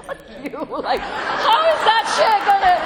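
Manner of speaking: high, loud laughing retort; laughing curse. Retold or imitated. Answering her sponsor, cursing while laughing high. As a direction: laugh through the mock curse. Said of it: "I was like".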